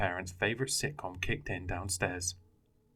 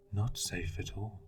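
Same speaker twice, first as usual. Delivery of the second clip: softer, slow hungry rasp; low raspy voice; 5 dB quieter, slower than usual. Acted. Unseen creature warns the boy, low and rasping. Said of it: "It was low, raspy".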